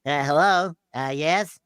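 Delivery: high, silly put-on phone voice; prank phone voice. Actor answers Bowser's call in a high silly voice. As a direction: pretend not to know the caller, pitched high. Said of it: "I go".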